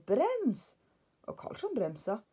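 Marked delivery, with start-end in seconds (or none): 0.1-0.7 high-pitched cry of "Brems!"; shouted warning. Acted. Lillebror shouts to Karlsson to brake, high-pitched. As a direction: yell at him to stop. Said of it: "ropte lillebror".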